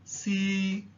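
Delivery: "si" held high and level in Cantonese tone one. Shown as a demonstration. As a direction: sing "si" on one high steady pitch. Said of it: "the high level tone, which stays at a high level".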